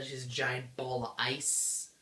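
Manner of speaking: usual speaking voice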